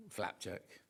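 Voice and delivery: usual speaking voice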